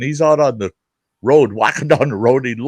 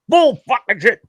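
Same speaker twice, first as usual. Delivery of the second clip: angry yell; 12 semitones higher than usual. Retold or imitated. Dad wrestling his son on the couch, high angry yell. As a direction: bark it back in the middle of the brawl. Dad yelling back mid-fight.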